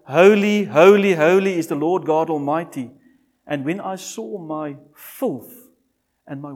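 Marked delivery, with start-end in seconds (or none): 0.1-2.9 much louder ringing Holy; proclaiming call. Retold or imitated. Preacher echoing the angels' cry of Holy, loud.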